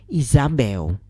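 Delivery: usual speaking voice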